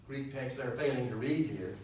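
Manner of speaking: normal talking voice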